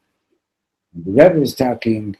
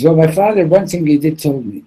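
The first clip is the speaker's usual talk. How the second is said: higher and louder, insisting; raised voice; 7 semitones higher, 7 dB louder than usual. Retold or imitated. Wife explaining to the preacher, higher and louder. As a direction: press the point a bit anxiously. Woman's voice retold.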